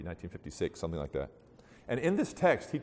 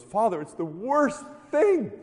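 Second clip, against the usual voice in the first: high, loud, drawn-out lament; hammed-up distraught woman; mock wailing; 10 semitones higher, 6 dB louder, slower than usual. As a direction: wail slowly in mock despair. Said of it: "crying her eyes out, like, wailing".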